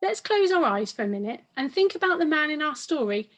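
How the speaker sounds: ordinary delivery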